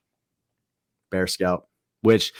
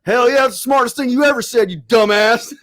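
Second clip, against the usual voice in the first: mock hearty cheer; 11 semitones higher, 8 dB louder than usual. Stepdad cheering in retold story.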